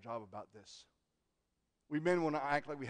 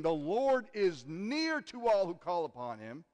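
scripture reading; 4 semitones higher, 6 dB louder, slower than usual. Acted.

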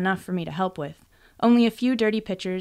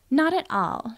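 smirking; 7 semitones higher, slower than usual. A narrator's reading. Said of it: "she smirked".

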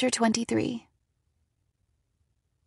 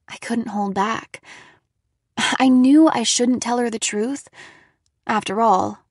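plain reading voice

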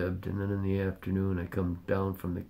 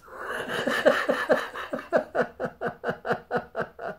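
high breathy laugh. Real, not acted.

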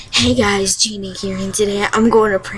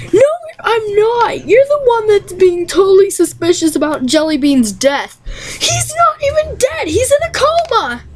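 much higher squeaky protesting voice; Jen impression; 10 semitones higher than usual. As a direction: pitch way up, indignant girl gamer. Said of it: "Okay, popular most".

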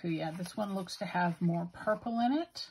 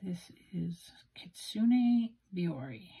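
whisper; slower than usual. Real, not acted. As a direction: whisper "Kitsune Biore" slowly, with no voice at all. Whispered retake of ink name.

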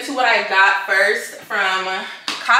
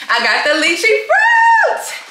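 excited shout; 12 semitones higher, 5 dB louder, slower than usual. Real, not acted. Showing the lychee fruit.

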